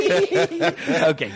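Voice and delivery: high, breathless laugh into "okay"; laughing as he settles down; real laughter. Real, not acted. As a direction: laugh it off after the joke. Laughing at his own joke.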